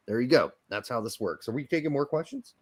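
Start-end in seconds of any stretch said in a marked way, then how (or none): none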